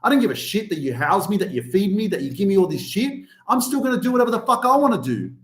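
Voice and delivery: put-on fast, defiant teenage outburst; fast defiant teen rant at parents; rebellious teen. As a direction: spit it out like a teen who won't listen. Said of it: "that teen is like".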